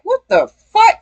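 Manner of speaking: high-pitched, acted "what on earth" reaction; mock disbelief. Acting out a listener hearing the fake voice, high disbelief. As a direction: jump up high in baffled shock. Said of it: "And you're like".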